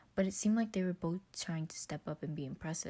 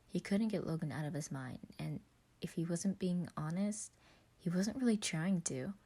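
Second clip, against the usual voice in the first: usual speaking voice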